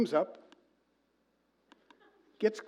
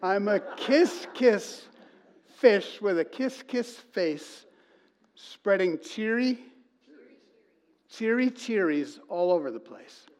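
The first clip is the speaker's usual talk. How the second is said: higher, slow and bright sing-song; cheery kiss fish; 4 semitones higher, slower than usual. Retold or imitated. Pastor reciting the happy ending, cheery higher sing-song. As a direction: beam through the rhyme like a delighted fish.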